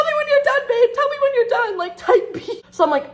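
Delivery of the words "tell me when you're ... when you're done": much higher, syrupy submissive voice; mock sweet pushover. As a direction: play the doormat girlfriend sweetly. Imitating her meek past self.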